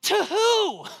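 high, puzzled to who; baffled question. Retold or imitated. Panicking girl answering her friend's advice, high bewildered. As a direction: ask it bewildered, voice jumping up. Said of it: "the other girl goes".

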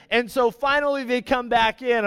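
normal talking voice

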